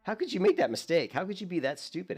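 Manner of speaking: high-pitched, exasperated telling-off of oneself; mock self-scolding. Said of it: "I just was yelling at myself".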